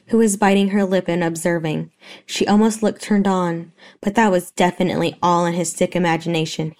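ordinary delivery, nothing marked

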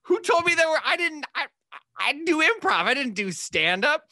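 high, pleading, flustered defense; panicked protest. Retold or imitated. Young comic protesting to the club manager, high and panicked. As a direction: squeak out a stunned excuse.

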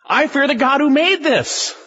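high, frightened exclamation; they realise whose storm it is; high awestruck fear; horrified sailors. Acted.

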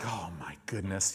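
low, hushed inner groan; embarrassed aside. Retold or imitated. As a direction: say it under your breath, mortified. Embarrassed aside before onlookers.

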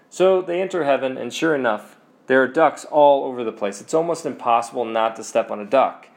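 ordinary delivery

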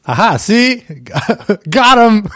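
triumphant laugh. Real, not acted. Host gleefully catches the friend's French croissant. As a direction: crow with delight at the catch.

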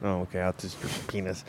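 muttered deadpan. Real, not acted.

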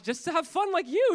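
acted playful answer, high and loud; teasing reply. Youth pastor answers the terrified girl, teasing. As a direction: pitch it up to tease.

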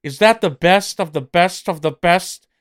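loud, high, drawn-out promo delivery; big slow boast, punching out each phrase; wrestling promo. Acted. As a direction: hype it like a ringside mouthpiece.

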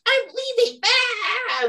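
high-pitched imitation of a yelling customer; mock yelling. Angry customer storming out of the clinic, high mock yell. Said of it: "she's just yelling at me".

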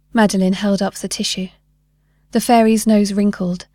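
ordinary delivery, nothing marked

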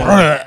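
loud mock burp of a word; short, loud burping grunt; burp-like bruh. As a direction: belch out BRUH, mocking the slang. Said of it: "It sounds like you're burping".

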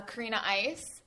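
ordinary talk

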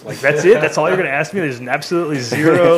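can't believe the question is this vague; mock incredulous. Answering a trade show visitor, mock incredulous. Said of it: "I'm like".

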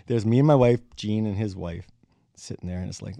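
usual speaking voice

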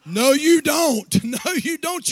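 normal talking voice

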